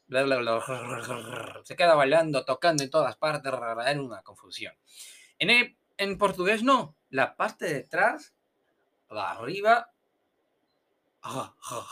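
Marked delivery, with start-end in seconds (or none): 0.1-1.6 babbled rolling tongue noise; mock flapping-tongue demonstration; tongue flapping about. Shown as a demonstration. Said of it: "la lengua se queda bailando". 11.2-11.9 throaty choked huffs; demonstrated guttural Portuguese R sound; drowning-dog rasp. Shown as a demonstration. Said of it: "es como si tuviera un".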